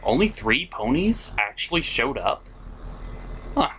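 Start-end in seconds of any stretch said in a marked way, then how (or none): none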